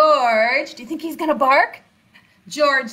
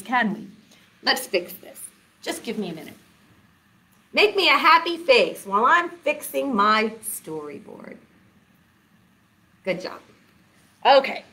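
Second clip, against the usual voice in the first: ordinary delivery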